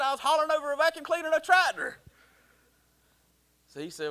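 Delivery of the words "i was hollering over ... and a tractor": high, loud, fast retold outburst; mock exasperated protest. Son explaining himself to brother, loud high mock exasperation. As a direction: burst out defending yourself, hammed up.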